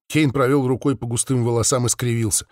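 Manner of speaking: ordinary delivery, nothing marked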